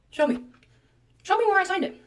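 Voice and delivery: angry demand. Retold or imitated. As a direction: challenge them, voice rising. Patient confronting staff, retold.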